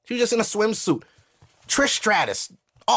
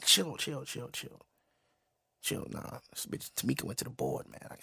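whispered, secretive; hushed whisper; 2 semitones lower, 14 dB quieter than usual. Retold or imitated. Teen whispers to friend about his boner. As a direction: hush it like you're in class.

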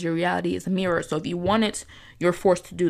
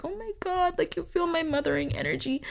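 mock gushing moon; 10 semitones higher, 5 dB quieter than usual. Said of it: "my Cancer moon just was like".

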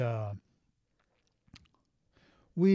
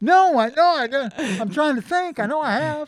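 flustered; 11 semitones higher, 8 dB louder than usual. Real, not acted. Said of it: "It's not that personal a question".

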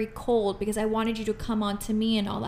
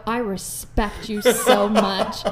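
earnest respect; 5 dB louder, much slower than usual. Retold or imitated. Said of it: "I go".

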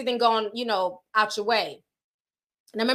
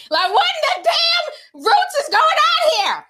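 high and loud, laughing through the outrage; laughing disbelief; 6 dB louder than usual. Retold or imitated. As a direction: squeal it, cracking up at the idea. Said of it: "I'm like".

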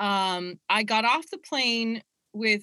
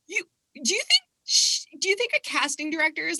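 incredulous; 6 semitones higher, faster than usual. Retold or imitated. Disbelief at actor's wrong assumption.

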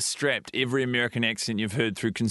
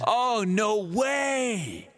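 high, very drawn-out American disbelief; American amazement; 9 semitones higher, much slower than usual. Acted. American stunned by a tourist's home, hammed-up American amazement. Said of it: "And I'll be America".

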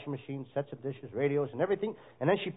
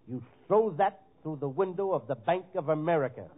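usual speaking voice

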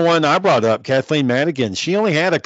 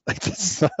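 laughing; 5 semitones higher, 6 dB quieter than usual. Real, not acted. Laughing at a terrible joke.

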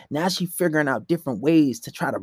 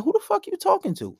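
high-pitched indignant challenge; indignant checking. Retold or imitated. Man checking a girl quick, indignant and high-pitched. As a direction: snap into a high, incredulous challenge.

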